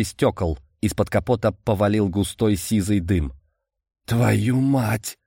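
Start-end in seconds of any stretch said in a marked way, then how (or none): 4.1-5.2 pained curse. Acted. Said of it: "выругался я, морщась от боли".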